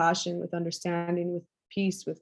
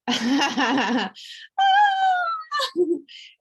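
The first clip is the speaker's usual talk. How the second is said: laughing; 10 semitones higher, 4 dB louder than usual. Real, not acted. Coach to her group of girls, laughing. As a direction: coach laughs with the women, high burst of laughter.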